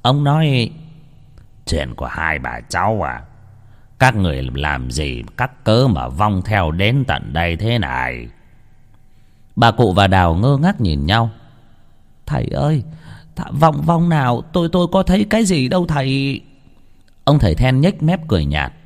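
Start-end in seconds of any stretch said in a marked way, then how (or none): 1.7-8.3 put-on low, soft, grim old shaman voice; an old sorcerer muttering darkly, lower and softer. Shaman confronting grandmother and Đào, low grim mutter. 12.2-16.4 put-on higher, stammering young woman's voice; a scared young mother pleading upward; frightened young woman. Đào questioning the shaman, high nervous stammer.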